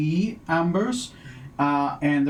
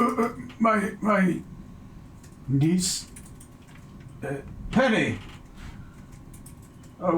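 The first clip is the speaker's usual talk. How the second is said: put-on flustered old man; 3 semitones higher than usual. Old William flustered at door.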